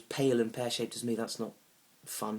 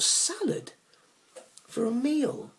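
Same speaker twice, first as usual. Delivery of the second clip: loud, very high-pitched disbelief; incredulous outburst; 7 dB louder, much slower than usual. Acted. Comic retelling a date's meal choice, loudly incredulous. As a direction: squeal it out, appalled. Aghast at date ordering salad.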